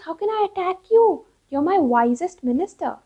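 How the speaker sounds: loud, high-pitched protest; acted royal outcry, starting high; aghast king.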